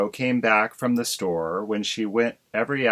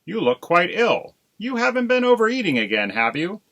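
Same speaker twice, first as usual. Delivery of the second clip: high, fast, loud teasing; teasing husband; 10 semitones higher, 4 dB louder than usual. Acted. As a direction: needle her gently but quickly. Husband teasing his wife.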